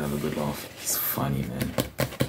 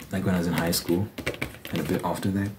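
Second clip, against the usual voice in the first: ordinary talk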